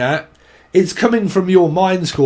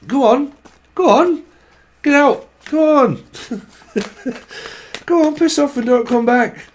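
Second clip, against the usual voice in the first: higher, curt dismissals; shooing someone off; 4 semitones higher than usual. Acted. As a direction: send them packing in short bursts. Acted shooing someone away.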